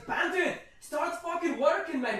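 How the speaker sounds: high, loud dwarven boss; barkeep hollering orders. Acted. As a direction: shout the staff into action. Barkeep calls orders to staff.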